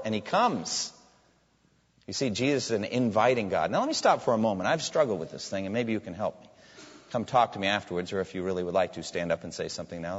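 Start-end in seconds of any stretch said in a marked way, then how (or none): none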